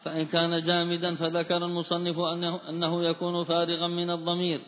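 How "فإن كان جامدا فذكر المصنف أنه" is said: ordinary talk, nothing marked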